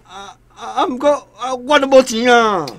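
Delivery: high, wailing plea; whimpering lament. Acted. Deshen lamenting to the judge he cannot pay, whimpering. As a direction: moan that you have no money.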